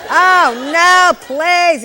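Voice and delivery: loud, high exasperated outcry; mock dismay. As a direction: wail it out in hammed-up horror. Mock outburst at day-shift nurse.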